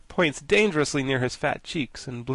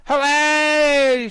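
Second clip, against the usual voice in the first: very high, loud delighted cheer; shrieking cheer; 11 dB louder than usual. Acted. The rebel girls cheer the news, shrieking. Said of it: "shrieked the rebels".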